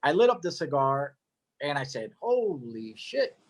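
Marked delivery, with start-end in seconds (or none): 2.2-3.4 drawn-out, awed exclamation; retold amazement. Storyteller reliving the first puff of the cigar, slow amazement. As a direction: stretch both words slowly in astonishment.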